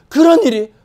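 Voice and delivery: high, loud disbelief; astonished relative. Acted. Relative hearing the servant's story, astonished. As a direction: react with acted amazement.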